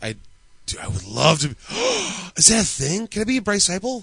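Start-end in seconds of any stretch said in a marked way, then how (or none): 1.6-2.3 excited gasp. Real, not acted. Excited at idea.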